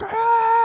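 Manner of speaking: high mock shriek of a scared child; shrill childish yelp, acted; mock scream. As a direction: cry out in panic, hammed up. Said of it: "And she's like".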